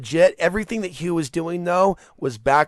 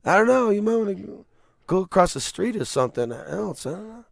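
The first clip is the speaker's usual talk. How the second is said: a hint of Italian mobster, higher; Joe Pesci impression; 6 semitones higher than usual. Lightly imitating a mobster warning someone off, higher. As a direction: lightly play a Casino wise guy. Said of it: "Joe Pesci in, in Casino".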